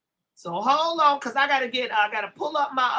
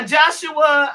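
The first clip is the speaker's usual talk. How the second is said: slow, louder welcome call to a joining guest; drawn-out greeting; 3 semitones higher, 7 dB louder than usual. Real, not acted. Host welcoming a guest on the stream, slow and loud. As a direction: stretch the name out like a host announcing.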